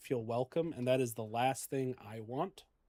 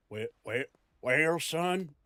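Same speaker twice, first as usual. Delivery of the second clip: repeated Southern well, well with falling well; Southern grandpa; 2 semitones higher, slower than usual. Shown as a demonstration. Grandpa scolding son in Southern accent, lightly done. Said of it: "I could go southern".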